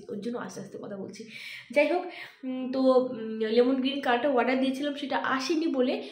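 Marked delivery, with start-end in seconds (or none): 0.1-1.2 low, much softer hushed voice; speaking quietly. Real, not acted.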